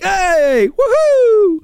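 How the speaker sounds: very high, put-on whoop; mock celebration. Debt-free people cheering despite inflation, mock celebration. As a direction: let out a silly falsetto cheer. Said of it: "happy for people like me and them".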